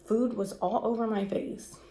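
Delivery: ordinary talk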